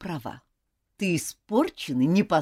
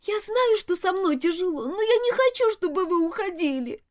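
put-on high pleading child; 13 semitones higher than usual. A narrator's reading. Girl keeps pleading governess.

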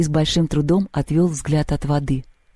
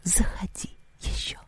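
soft unvoiced whisper; 2 semitones higher, 11 dB quieter than usual. A narrator's reading. Water spirit to the departing soldier, whispered. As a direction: whisper a teasing invitation.

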